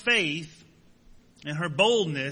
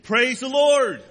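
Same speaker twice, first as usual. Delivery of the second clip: slow, loud, high shout of praise; delighted, drawn-out shout of thanks; joyful shout; 6 semitones higher, 7 dB louder, slower than usual. Acted. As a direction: cry it out when you find the groceries. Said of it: "and shouted".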